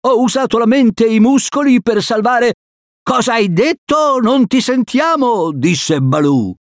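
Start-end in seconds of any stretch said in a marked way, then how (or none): 0.1-2.5 high-pitched proud call; high raised voice; calling across. Acted. Calling to friends across the river. 3.0-5.5 high-pitched straining call; calling across. Acted. Calling back, cannot hear across river.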